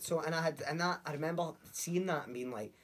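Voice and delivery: ordinary delivery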